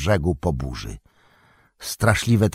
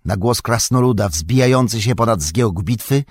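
usual speaking voice

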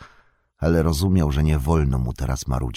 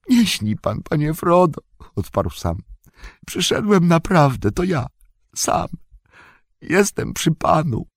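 Sam, tearful and tender; 12 semitones higher than usual. A narrator's reading. Sam comforting Frodo in his arms, tender tearful voice. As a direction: comfort him gently through tears. Sam's voice.

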